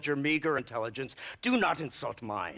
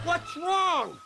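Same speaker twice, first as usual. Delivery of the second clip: alarmed; 4 dB louder than usual. Acted. Car breaks down under fire.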